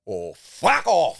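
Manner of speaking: slow emphatic mock brush-off; loud mock dismissal. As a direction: bark it out slowly at the audience. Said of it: "as you said at the start".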